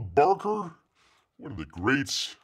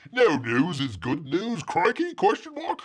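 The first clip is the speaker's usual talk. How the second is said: put-on Australian accent; 6 semitones higher than usual. Host reading a viewer comment in mock Australian accent. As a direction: ham up a bad Australian accent. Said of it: "I gotta do this in the accent".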